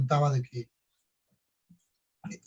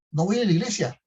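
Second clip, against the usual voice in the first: higher, louder stubborn refusal; defiant shout; 6 semitones higher, 5 dB louder than usual. Retold or imitated. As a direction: plant your feet and refuse like a teen. Son refuses again.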